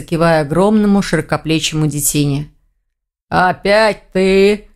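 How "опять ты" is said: slow, drawn-out orc grumble; huffing orc. A narrator's reading. Orc to his visitor in the doorway, huffing. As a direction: huff it out slowly as the orc. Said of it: "хмыкнул орк".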